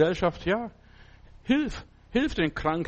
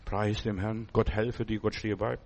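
quiet blessing; 7 semitones lower, 6 dB quieter than usual. Real, not acted. Quiet prayerful blessing aside.

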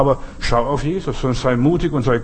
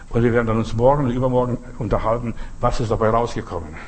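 ordinary talk

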